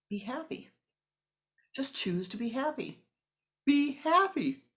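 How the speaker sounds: the smiling yogi urging his class; joyful teaching. Acted. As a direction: sing out the command with delight.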